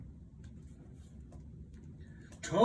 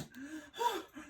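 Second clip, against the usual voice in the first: gasp; 12 semitones higher, 6 dB quieter than usual. Acted. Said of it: "cried Toad".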